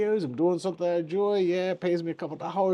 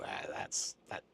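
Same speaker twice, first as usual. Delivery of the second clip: whispered aside; 16 dB quieter than usual. Real, not acted. Mock dismissive whispered aside.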